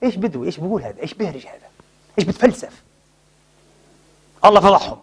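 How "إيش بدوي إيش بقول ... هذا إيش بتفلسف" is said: sneering hypocrites fading to a whisper; mock the Prophet under your breath; scornful mockery. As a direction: hypocrites mocking, dropping to a hush. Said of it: "بيطلعوا".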